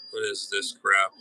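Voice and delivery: normal talking voice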